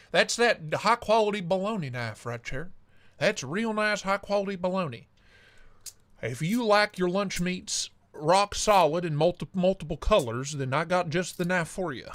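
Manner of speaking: a hint of a hammed-up Southern salesman; southern accent. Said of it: "stuck in my southern accent".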